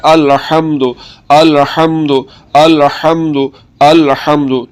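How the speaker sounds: अलहम्दु with the ह from the middle of the throat; correct throaty ha. Shown as a demonstration. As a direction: say अलहम्दु with the ह pushed from mid-throat, slowly repeated. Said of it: "हलक के दरमियान वाले हिस्से से आवाज़ को निकाल कर".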